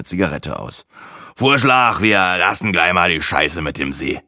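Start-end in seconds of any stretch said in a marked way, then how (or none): 1.4-4.2 smug show-off. A narrator's reading. Braggart bossing the group, cocky. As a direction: lay down the law, cocky.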